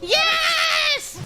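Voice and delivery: victory cheers. Real, not acted. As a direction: celebrate the win.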